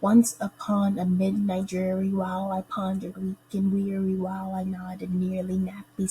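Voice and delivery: even-pitched verse reading beating every first syllable, "ONCE u-PON a MID-night DREAR-y"; chanted alternate stresses from "Once upon" on, strong then weak; emphasized trochaic meter. Shown as a demonstration. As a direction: read "Once upon a midnight dreary" in strong-weak beats through to "chamber door". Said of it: "read and then emphasize the meter".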